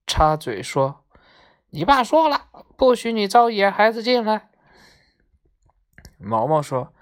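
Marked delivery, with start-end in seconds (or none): 1.7-4.5 high-pitched parrot butting in; bossy parrot. A narrator's reading. Green parrot butts in to warn Maomao, high bossy voice. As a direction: cut in as a scolding parrot, high. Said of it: "绿鹦鹉 插嘴说".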